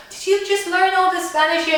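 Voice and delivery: high, loud astonished voice; amazed question. Retold or imitated. Holiday friends asking about her Spanish, mock amazement. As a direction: imitate someone incredulously impressed.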